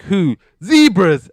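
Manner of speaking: loud, high sitcom delivery; hammed-up jeer landing the joke; mocking punchline. George trading insults at an animal funeral, mocking.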